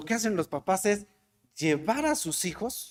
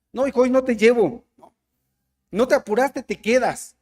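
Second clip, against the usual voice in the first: scolding parent; 5 semitones higher, 5 dB louder than usual. Retold or imitated. Parent scolding son left behind.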